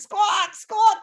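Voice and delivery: high, loud squawks; pained chicken squawk. Retold or imitated. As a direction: squawk like chickens being plucked.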